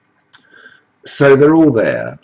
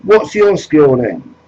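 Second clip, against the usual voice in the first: a higher, louder slow demand; gruff gatekeeper; 6 semitones higher, 5 dB louder than usual. Acted. Jesus Farage vets the migrant below, mock stern. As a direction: bark it down at them like a border guard.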